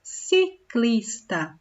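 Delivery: "ciclista" said very slowly, both "ci" like "si"; soft C as S. Shown as a demonstration. Teacher showing pupils the S sound of C before I. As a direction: draw out the word syllable by syllable. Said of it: "fica com som de si".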